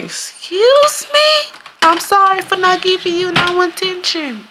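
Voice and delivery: very high drawn-out excuse me; outraged disbelief. Retold or imitated. Cheated partner to cheater, high outraged mock apology. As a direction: squeal it in shocked disbelief.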